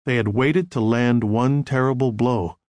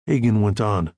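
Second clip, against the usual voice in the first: ordinary delivery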